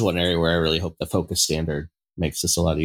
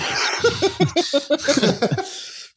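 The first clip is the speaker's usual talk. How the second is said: high laugh. Real, not acted. Laughing at being called an asshole.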